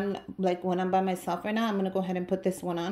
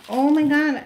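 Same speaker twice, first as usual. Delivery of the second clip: much louder, higher burst of joy; excited gasp; 7 semitones higher, 9 dB louder than usual. Real, not acted. Discovering a fall shirt, excited gasp. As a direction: let the surprise burst out.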